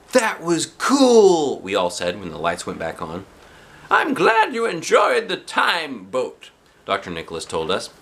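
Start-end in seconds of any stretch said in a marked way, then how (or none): 0.1-1.6 put-on high, slow dragged-out kid voice; kids' voice. The whole class reacting to the time boat. 3.9-6.5 high, slow sweet teacher voice. Acted. Doctor Nicholas telling the kids the ride is over.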